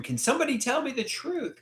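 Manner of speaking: a pleading cry pitched high but held back; mild mock scream. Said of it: "I wanna scream".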